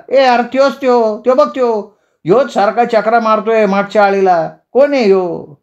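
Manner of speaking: high-pitched calling out; excited cry. Acted. Ganpya spots the cycling stranger and alerts his friends, high excited cry. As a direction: point him out in an urgent high cry. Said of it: "त्याला बघताच गणप्या ओरडला".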